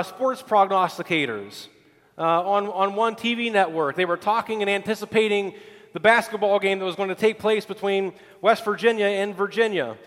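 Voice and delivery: normal talking voice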